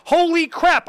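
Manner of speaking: a hammed-up gasp of disbelief; mock astonishment. Real, not acted. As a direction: react like the catalog shocked you. Reacting to the catalog.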